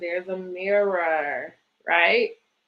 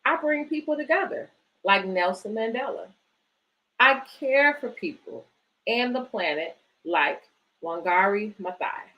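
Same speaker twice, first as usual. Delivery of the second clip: put-on reading to children. Reads picture book aloud.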